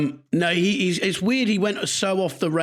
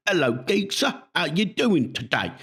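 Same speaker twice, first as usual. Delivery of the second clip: mock bad Cockney accent, starting high; Al Pacino; 4 semitones higher than usual. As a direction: imitate an American badly faking Cockney. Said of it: "I'm gonna do the Cockney accent".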